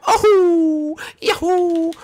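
high squeaky Mario yell; Mario yahoo. Retold or imitated. Mimicking Mario's cry in game.

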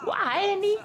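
high falsetto question; squeaky put-on voice; mock greeting. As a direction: copy the silly cartoon voice.

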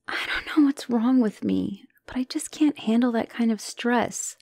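hushed unvoiced whisper; whispered fears. Girlfriend confiding her fear of the family, whispering. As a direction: let it slip out as a breathless secret.

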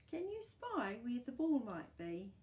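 ordinary delivery

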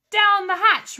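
high, loud group cheer; crew shout. A narrator's reading. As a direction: shout it back like rowdy pirates. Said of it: "we all shouted".

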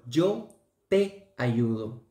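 "yo te ayudo" spelled out slowly, stress on "te"; slow example. Shown as a demonstration. Teacher giving a Spanish example to students, slowly, stressing "te". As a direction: lay out "yo te ayudo" word by word, leaning on "te". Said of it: "por ejemplo".

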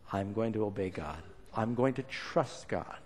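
a hushed self-promise to trust God; quiet inner resolve. Acted. Abraham resolving inwardly.

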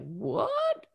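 high startled what; sharp rising what; sudden surprise. Retold or imitated. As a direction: perk up mid-fog, jolted awake. Housing offer catches her attention.

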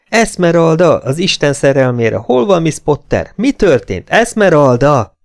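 high, loud, pleading questions; urgent yelling. A narrator's reading. Clayton shaking the fainted woman, urgent yelling. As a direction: call out urgently to rouse her. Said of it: "üvöltött a fülébe".

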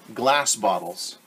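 ordinary delivery

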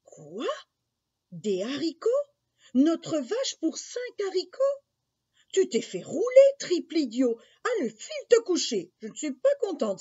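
disbelief building to high, loud scolding; outraged mother. Acted. Mother learns the cow went for beans, furious scolding. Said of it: "s'écria la maman".

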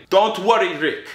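high-pitched, impatient brush-off; imitated agitated reassurance. Driver answering a nagging passenger, agitated. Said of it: "Now he's getting a bit agitated back".

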